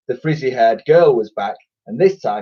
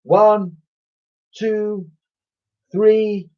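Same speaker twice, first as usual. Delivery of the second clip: higher, louder count; 8 semitones higher, 4 dB louder, slower than usual. A narrator's reading. Boy leading the twins to shout together. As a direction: lead the group in. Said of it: "Ned counts them in".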